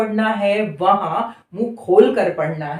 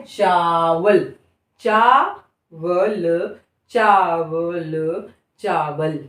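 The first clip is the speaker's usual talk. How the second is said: "चावल" drawn out very slowly, then fading lower; slow practice repeats; 4 semitones lower, much slower than usual. Shown as a demonstration. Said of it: "तीन चार बार अभ्यास करिए". ("चावल" को धीरे-धीरे दोहराना)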